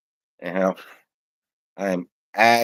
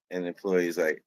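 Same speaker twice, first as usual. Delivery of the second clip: ordinary delivery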